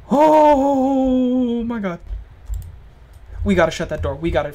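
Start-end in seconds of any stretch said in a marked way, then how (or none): 0.1-2.0 very slow, loud, high oh my god; drawn-out fear. Real, not acted. Gamer terrified by the monster, slow loud cry. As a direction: stretch it out in terror.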